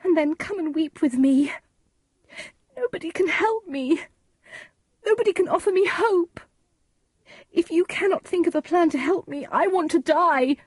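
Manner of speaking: high, tearful wailing; high-pitched, anguished despair; Juliet. A narrator's reading. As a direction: cry out hopelessly, pitch held very high. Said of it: "she cried".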